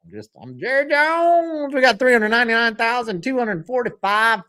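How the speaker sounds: hammed-up high-pitched impression; Jerry Jones. Shown as a demonstration. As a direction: sustain the name high, then rattle on. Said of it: "say his name and then you go".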